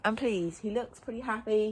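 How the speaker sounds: usual speaking voice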